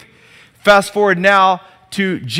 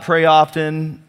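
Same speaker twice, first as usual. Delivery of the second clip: pray often, slowed; slower than usual. Shown as a demonstration. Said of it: "as opposed to".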